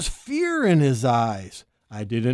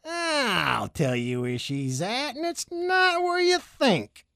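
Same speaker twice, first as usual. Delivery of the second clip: sly snake voice; 7 semitones higher, 5 dB quieter than usual. A narrator's reading. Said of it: "gave me a wink".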